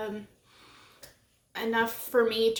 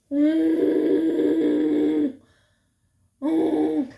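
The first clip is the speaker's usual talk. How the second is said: loud high hum; 5 semitones higher, 9 dB louder than usual. Real, not acted. Reacting to cat outside.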